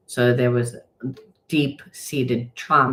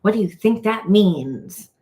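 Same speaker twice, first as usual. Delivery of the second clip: voicing Spirit; 8 semitones higher, 5 dB louder than usual. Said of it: "It's like".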